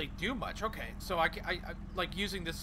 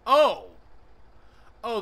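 sudden loud startled oh; surprised; 5 semitones higher, 14 dB louder than usual. Real, not acted. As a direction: blurt it out in surprise. Sudden discovery in the game.

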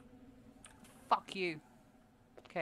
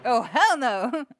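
much louder, slow and drawn out; loud horrified refusal; 3 semitones higher, 12 dB louder, much slower than usual. Real, not acted. Streamer facing a monster, loud drawn-out refusal. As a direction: bellow it in disbelief. Scare in game.

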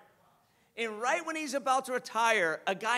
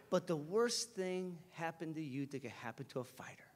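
low, hushed mentor's verdict; grave heart-to-heart; 8 semitones lower, 9 dB quieter than usual. Retold or imitated. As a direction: deliver the hard truth softly, sinking lower.

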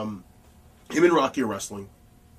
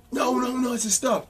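much higher, insistent retold objection; high-pitched protest; 12 semitones higher, 3 dB louder than usual. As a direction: cut in with high, urgent noes. Retold objection in locker-room argument.

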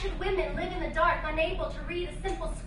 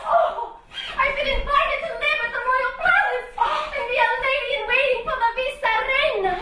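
high, loud excited rush; high loud breathless excitement; thrilled; 7 semitones higher, 6 dB louder than usual. Acted. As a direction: burst out the good news. Thrilled news to aunt.

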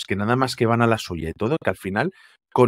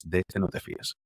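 advertencia susurrada; 4 semitones lower, 9 dB quieter than usual. Retold or imitated. Asesor advierte en voz baja.

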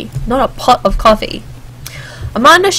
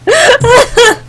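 high, loud burst of laughter; real high-pitched laugh; amused laugh; 13 semitones higher, 5 dB louder than usual. Real, not acted. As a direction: burst out laughing at a silly joke. Laughs at a joke on screen.

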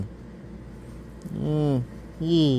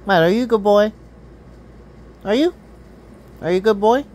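talking to a cat; 5 semitones higher, much faster than usual. Real, not acted. Cooing praise at the cat.

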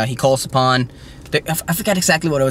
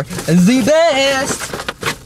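drawn-out praise; 10 semitones higher than usual. Pretend. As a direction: draw it out like a show announcer. Hyping up his viewers.

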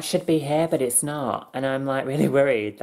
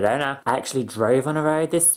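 usual speaking voice

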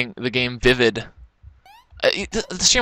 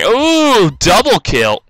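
high, loud whoop; excited exclamation; 9 semitones higher, 5 dB louder, much slower than usual. Real, not acted. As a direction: crow it like a game announcement. Exclaims at double death in game.

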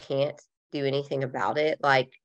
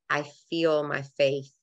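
ordinary talk, nothing marked

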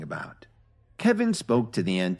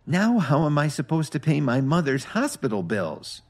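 a touch of a sob, pitched up; mock sobbing; 7 semitones higher than usual. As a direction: plead as if about to cry, lightly acted. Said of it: "McKay sobbed".

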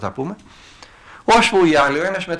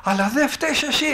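demon howling; 9 semitones higher than usual. Retold or imitated. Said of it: "αρχίζει να ουρλιάζει ο άλλος".

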